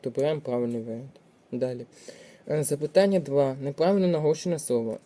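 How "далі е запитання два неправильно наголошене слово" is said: ordinary talk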